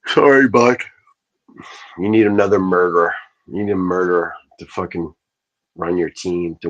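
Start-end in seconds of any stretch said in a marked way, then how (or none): none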